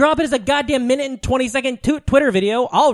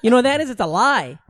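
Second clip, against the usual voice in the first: usual speaking voice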